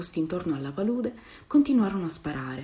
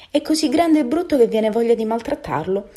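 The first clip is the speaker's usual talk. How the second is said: loud, higher jeer, lightly acted; mocking; 5 semitones higher, 7 dB louder than usual. A narrator's reading. Big duck taunting the ugly duckling, mocking. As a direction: taunt with open scorn. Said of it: "con tono beffardo".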